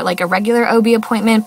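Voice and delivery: ordinary talk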